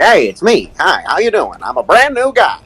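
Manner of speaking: high, put-on cartoon newcomer; hammed-up replacement voice; new guy. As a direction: introduce yourself in a high, swooping character voice. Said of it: "got a new guy and was like".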